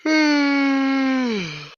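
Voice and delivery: heavy sigh, almost a groan; loud exasperated sigh. Acted. Nurse sighing loudly at the patient.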